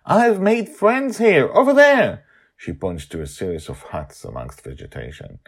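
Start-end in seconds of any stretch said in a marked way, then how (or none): 0.0-2.2 high, bright excited squeal. A narrator's reading. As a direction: gush like a thrilled kid. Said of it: "Madeline is excited".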